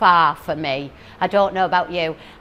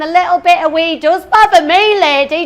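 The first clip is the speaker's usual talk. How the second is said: higher, louder outraged comeback; indignant retort; 8 semitones higher, 6 dB louder than usual. Retold or imitated. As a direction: snap it back at the advert lady. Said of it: "I'm thinking".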